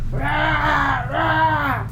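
mock growl of a wild thing; monster roar. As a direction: play at being a monster. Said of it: "Vous avez le droit de le faire aussi". (« Rah, rah » grogné comme un monstre)

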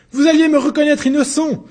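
high, loud jolted cry; startled outcry. Acted. Accused protesting to the judges, loud high cry. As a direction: burst out in a sudden jolt. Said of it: "s'écria Martin dans un sursaut".

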